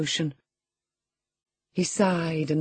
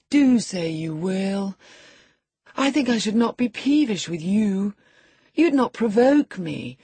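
put-on higher, coaxing boy's voice; pleading sickly boy; 6 semitones higher, 4 dB louder than usual. A narrator's reading. Boy begging his cousin to visit, plaintive and higher. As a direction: plead with her to come and stay.

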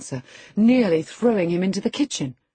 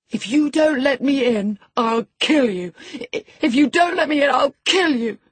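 high, loud screaming threat; shrieking threat; 8 semitones higher, 5 dB louder than usual. A narrator's reading. Linton at the locked door, shrieking high and loud. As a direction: shriek it in powerless fury. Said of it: "He rather shrieked than said".

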